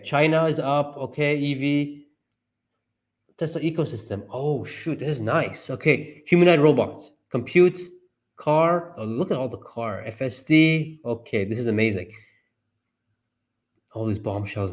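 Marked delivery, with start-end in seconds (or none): none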